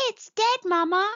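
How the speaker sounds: very high little-creature voice; little mole. Acted. Little mole looks at a shriveled bulb, high-voiced.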